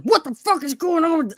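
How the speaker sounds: angry retold exclamation; retold anger. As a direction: vent it like you're reliving the outrage. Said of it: "I was so angry".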